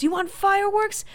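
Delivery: loud, high acted challenge; angry threat. Maria about to smash the plates, angry threat. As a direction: throw it back as a heated threat.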